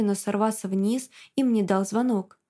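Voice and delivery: plain reading voice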